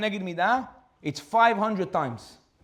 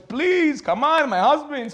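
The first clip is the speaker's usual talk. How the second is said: much higher, louder, slow plea; begging; 9 semitones higher, 6 dB louder, much slower than usual. Acted. Widow begging the butcher to sell it back, pleading. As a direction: plead for her husband's sake.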